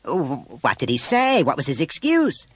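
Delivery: stammered start, then high, loud questions; discomfited. A narrator's reading. Mother learning of muddy flexies, discomfited. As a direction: demand answers, rattled. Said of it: "the discomfiture of the other woman".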